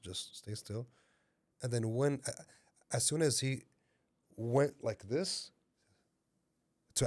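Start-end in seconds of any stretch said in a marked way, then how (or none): 0.0-0.9 soft and low; soothing inner voice. Retold or imitated. Inner voice to the threatened man, soft and low. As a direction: gently urge stillness, voice dropped. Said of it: "and then that voice says".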